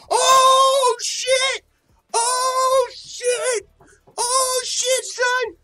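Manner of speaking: screaming oh shit, very high and loud; hype shout. Real, not acted. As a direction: lose it at the line. Hyped reaction to line.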